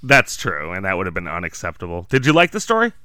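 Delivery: ordinary talk, nothing marked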